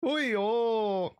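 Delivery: high, stretched catchphrase cry; Uncle Roger. Acted. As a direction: let it soar and stretch out. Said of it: "what the Uncle Roger".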